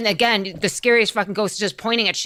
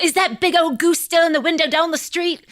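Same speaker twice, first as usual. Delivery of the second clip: put-on higher, excited old-man question; Scrooge asking; 8 semitones higher than usual. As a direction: ask about the goose, eager and pitched up. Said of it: "he's like".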